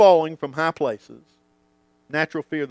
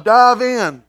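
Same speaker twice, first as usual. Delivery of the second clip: higher, louder confident urging; Dad calling; 5 semitones higher, 7 dB louder than usual. Retold or imitated. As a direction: cheer him into the water. Dad answering back.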